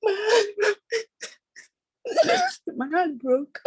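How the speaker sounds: high-pitched sobs between the words; real heartbroken crying. Real, not acted. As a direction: weep through it, barely getting words out. Crying over a friend's accusation.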